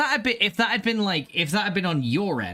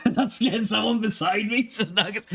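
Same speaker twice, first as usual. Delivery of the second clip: laughing; 7 semitones higher than usual. Real, not acted. Laughing while reading chat.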